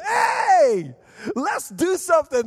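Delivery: wired dad shouting to his family; hyped-up call. Retold or imitated. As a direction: burst in on the sleepy family all wired. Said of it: "I'm just so excited".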